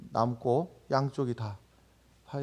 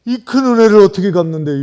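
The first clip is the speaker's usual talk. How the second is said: louder Chungcheong dialect cry in prayer; 6 semitones higher, 17 dB louder than usual. Retold or imitated. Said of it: "충청도 말로다가". (크게 외치는 충청도 사투리 기도)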